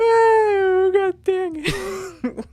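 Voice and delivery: very high, stretched, almost crying with laughter; helpless laughing wail. Real, not acted. Streamer losing the fight to settle down, high laughing wail. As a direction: wail it out, beaten by the giggles.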